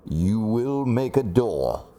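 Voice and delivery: slow, drawn-out mock Darth Vader; lightly hammed Vader, word by word. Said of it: "That's pretty awful, Darth Vader".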